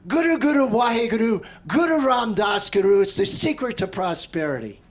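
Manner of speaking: loud, high proclaiming chant of the mantra; imitating a booming teacher. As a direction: boom out the mantra like a commanding teacher. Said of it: "and he goes".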